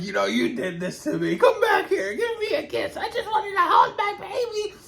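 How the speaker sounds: high, eerie monster-mother voice with a laugh; monstrous mother. Retold or imitated. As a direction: croon like a ghoulish mother calling her baby. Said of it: "her mom was like".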